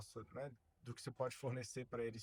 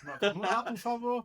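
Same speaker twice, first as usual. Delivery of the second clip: real, emphatic go-ahead, pitched up; loud playful urging; 9 semitones higher, 15 dB louder than usual. Real, not acted. Host urging guest to go on with his pitch, loud and eager. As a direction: burst out high and very loud. Jokingly urges him to keep pitching.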